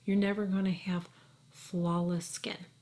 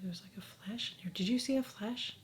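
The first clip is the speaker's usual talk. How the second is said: fast, much softer whisper, a little uneasy; spooked whisper; 10 dB quieter than usual. Real, not acted. Vlogger spooked by a flash, whispering to viewers. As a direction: whisper it like something's in the room.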